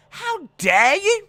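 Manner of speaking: high, loud, slow how dare you; mock outraged shriek. Defending Thug Rose, hammed-up high outraged cry.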